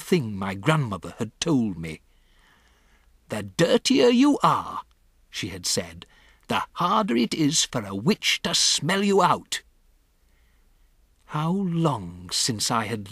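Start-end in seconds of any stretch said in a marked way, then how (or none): 3.3-4.8 higher-pitched grandmother voice; quoted grandmother. Acted. 6.5-9.6 higher-pitched grandmother voice; quoted grandmother. Acted.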